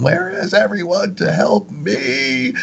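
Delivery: high, drawn-out wailing plea; mock crying out. Attacked man calls out to the universe, high slow mock wail. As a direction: stretch it into a high theatrical call for help. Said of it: "crying out to the universe going".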